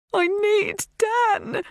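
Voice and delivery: slow, high, tearful cry. A narrator's reading. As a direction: plead for him through tears. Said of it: "tears flooding my eyes".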